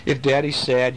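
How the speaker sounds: ordinary delivery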